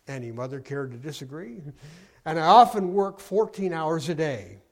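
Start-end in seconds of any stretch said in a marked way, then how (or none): 2.2-4.6 mock grand. Mother inflating her job title.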